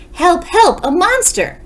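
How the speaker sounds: high, panicky calls for help; frightened cry for help. Acted. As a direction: cry out for your sister in fright. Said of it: "shouted Baby".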